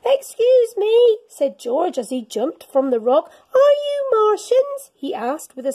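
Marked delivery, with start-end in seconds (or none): 0.1-1.2 put-on higher, louder boy's voice; polite little boy calling out; George voice. 3.5-4.9 high, loud eager question; George asking. Acted. George asks aliens.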